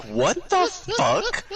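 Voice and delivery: very high, slow, drawn-out disbelief; high squeaky shocked what the fuck; stunned. Retold or imitated. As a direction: stretch it out in shock. His shocked reaction quoted.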